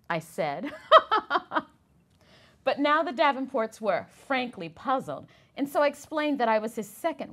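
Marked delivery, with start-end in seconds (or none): 0.8-1.6 short high loud laugh. A narrator's reading. Wife laughs after confirming her husband is Du Bois, bright laugh. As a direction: burst out laughing, pleased.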